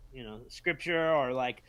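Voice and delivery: usual speaking voice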